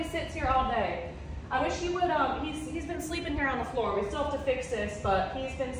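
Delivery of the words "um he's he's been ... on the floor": ordinary delivery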